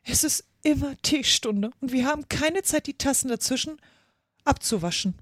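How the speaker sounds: high-pitched, mildly woeful; sighing complaint. A narrator's reading. Hatter explaining endless teatime to Alice, sighing. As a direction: lament with a touch of a sigh. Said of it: "mit einem Seufzer".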